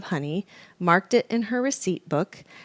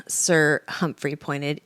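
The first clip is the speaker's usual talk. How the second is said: usual speaking voice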